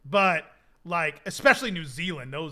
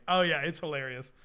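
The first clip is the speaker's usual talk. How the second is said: normal talking voice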